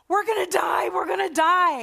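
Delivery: high, loud panicked wail; panicked cry. Retold or imitated. Israelites to Moses as Egyptians close in, panicked shout. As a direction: cry out that death is coming.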